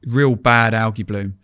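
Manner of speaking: usual speaking voice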